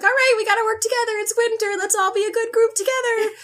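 high, fast counselor voice; cheery pep talk; guidance counselor bird. Retold or imitated. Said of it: "they're like".

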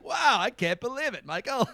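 high, amazed buddy voice, lightly done; gushing with wonder in a put-on voice; Sam Axe impression. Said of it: "He was like".

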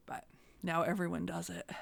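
hushed whisper. Real, not acted. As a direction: drop to a whisper. Joking aside about headsets.

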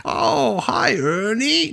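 very high, drawn-out puppet-show greeting; hammed-up high children's TV character voice; loud, squeaky-high hello to a puppet pal; Mister Hooper. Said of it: "that's how you just did that".

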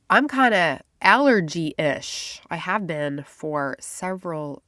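ordinary delivery

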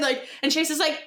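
normal talking voice

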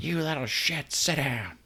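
high, hammed-up scolding; mock yell at kids, held back in volume; yelling bus driver. Stoned bus driver to the kids, mock yelling. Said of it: "drive the bus around".

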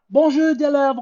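high, bright French bonjour; a lightly voiced French teacher; French teacher greeting. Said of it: "the teacher goes".

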